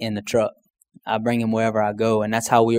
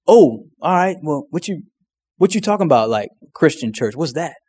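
puzzled card player; 4 semitones higher than usual. Retold or imitated. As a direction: voice the puzzled guy at the card table.

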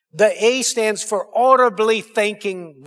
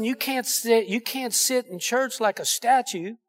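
ordinary talk

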